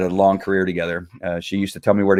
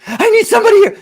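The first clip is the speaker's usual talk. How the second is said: high, loud, frantic cry for backup; a stressed cop losing his calm on the radio; panicked radio call; 8 dB louder, faster than usual. Retold or imitated. Mocking a panicked officer calling dispatch, high and loud. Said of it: "their voice would elevate".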